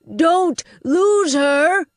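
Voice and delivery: slow, loud roar of an order; bellowed. Acted. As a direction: bellow at the Masters of the Dead. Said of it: "Hugh bellowed".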